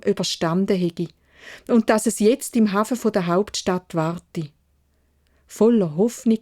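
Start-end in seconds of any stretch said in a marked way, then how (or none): none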